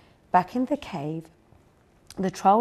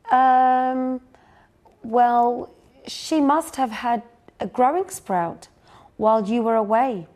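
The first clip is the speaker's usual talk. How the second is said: put-on nervous girl fibbing; 4 semitones higher, 6 dB louder than usual. Zoe nervously covering up.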